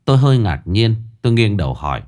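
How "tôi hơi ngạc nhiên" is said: usual speaking voice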